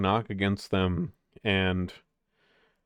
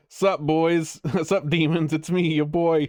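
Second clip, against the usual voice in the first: high, loud bro greeting to demons; cocky ghost hunter; 8 semitones higher, 6 dB louder than usual. Pretend. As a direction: hype ghost-hunter show host, laughing. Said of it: "taking the, the ghost hunter's route".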